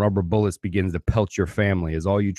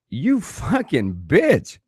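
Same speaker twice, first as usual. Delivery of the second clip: slow seething insult; 7 semitones higher, much slower than usual. Pretend.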